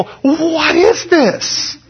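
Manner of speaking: very slow, high, drawn-out wonder; mock-astonished newcomer; amazed visitor. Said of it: "they go".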